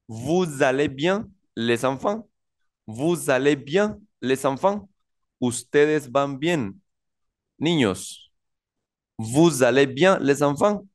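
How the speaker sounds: "Vous allez bien" muy pausado, con subida final; lectura lenta en francés. Shown as a demonstration. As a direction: lee la pregunta despacio para los alumnos. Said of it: "leo los dos ejemplos de acá". (profesor lee el ejemplo en francés despacio)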